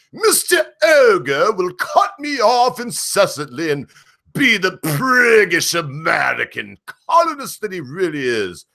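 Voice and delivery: high, indignant British accent, hammed up; mock a huffy Brit, stretching words out, loud and high; exaggerated British accent climbing to an outraged squeal; Britisher impression. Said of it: "pressure the Britisher in such a way".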